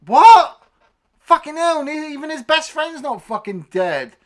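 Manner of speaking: high, loud exasperated disbelief; incredulous outburst. Retold or imitated. Reviewer reacting to Roger surviving, incredulous outburst. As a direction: start on a yelped what and rant on.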